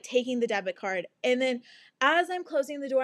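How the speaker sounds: ordinary talk, nothing marked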